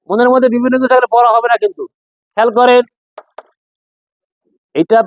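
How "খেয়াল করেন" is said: usual speaking voice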